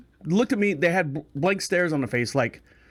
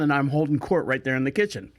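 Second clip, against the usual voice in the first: normal talking voice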